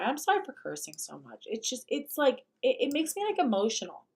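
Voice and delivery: ordinary talk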